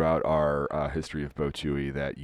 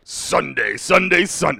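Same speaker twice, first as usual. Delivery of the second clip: loud, high event-promo shout; hype announcer; 11 semitones higher, 9 dB louder than usual. Pretend. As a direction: belt it out like an arena ad. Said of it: "Do you wanna hear mine?".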